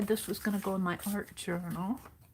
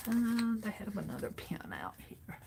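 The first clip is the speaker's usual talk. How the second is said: whispered aside to herself while pinning; 7 dB quieter than usual. Real, not acted. Crafter muttering to herself at the desk, whispering. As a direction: breathe it out under her breath.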